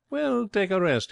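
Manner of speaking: high, slow, indulgent tone; drawn-out, gentle condescension; kindly. A narrator's reading. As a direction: kindly let him rest. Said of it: "he added kindly".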